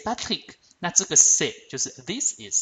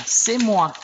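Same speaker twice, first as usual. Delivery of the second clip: higher, louder heroine answering, it's me; heroine's reply; 8 semitones higher, 5 dB louder than usual. Acted. The heroine announcing herself to whoever calls Hélène. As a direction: speak up proudly as the heroine. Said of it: "通常那个女主角要讲".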